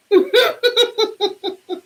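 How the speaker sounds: high falling laugh. Real, not acted. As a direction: burst into a laugh. Laughs at her own threat.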